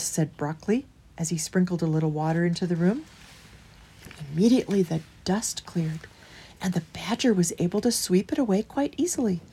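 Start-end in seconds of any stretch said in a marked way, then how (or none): none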